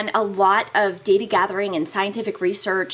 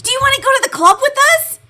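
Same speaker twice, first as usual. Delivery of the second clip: high, fast eager invitation; bright eager ask, rushed; excited invitation; 9 dB louder, faster than usual. Retold or imitated. Retold invitation to club.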